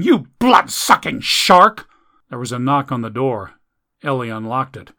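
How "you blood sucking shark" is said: furious high-pitched scream. Acted. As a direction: scream it, slow and bitter. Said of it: "she screamed at the phone".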